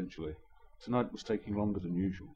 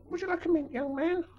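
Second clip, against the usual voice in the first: put-on sweet old lady. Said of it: "she inquired".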